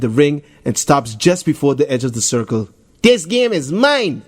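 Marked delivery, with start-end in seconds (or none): 3.0-4.2 slow, high proud declaration; mild hammed-up bragging; boastful. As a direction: announce victory to the crowd. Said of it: "Jason tells the crowd".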